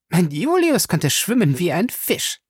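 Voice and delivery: high-pitched, proud wifely boast. A narrator's reading. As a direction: brag fondly about your husband. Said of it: "sagte sie stolz".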